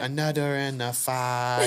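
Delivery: put-on slow, sung in a tiny toddler voice; baby voice. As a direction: sing it like a toddler. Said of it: "that little tiny baby voice".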